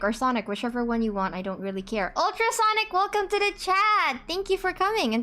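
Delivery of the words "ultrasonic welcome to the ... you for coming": excited greeting. Real, not acted. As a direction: greet the newcomer with delight. Greeting a new viewer.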